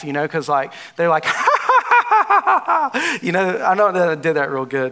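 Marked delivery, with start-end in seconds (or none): 1.3-3.2 high, evil cackle; Joker laugh. Shown as a demonstration. Preacher shows the congregation a practiced Joker cackle. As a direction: cackle like a crazed villain. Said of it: "I was practicing my laugh".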